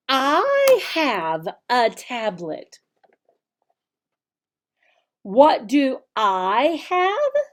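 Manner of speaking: slow, exaggerated teacher voice, brighter on questions; slow teacher modeling. Shown as a demonstration. Teacher modeling the lesson for children, slow and clear. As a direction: model each sentence slowly and clearly for little kids. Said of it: "That's how you teach it".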